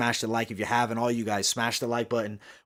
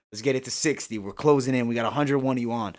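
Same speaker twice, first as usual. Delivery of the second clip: usual speaking voice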